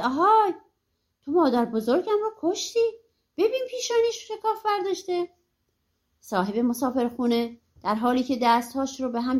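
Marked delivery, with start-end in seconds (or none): 0.0-5.3 higher-pitched, understated narrated cry; a touch of outraged calling out; mild accusing shout. A narrator's reading. Little Claus accusing the innkeeper of killing grandmother, mildly shouted. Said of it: "گردن مسافرخانه دار رو گرفت و فریاد زد".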